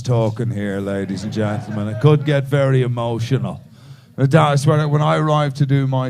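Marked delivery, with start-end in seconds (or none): none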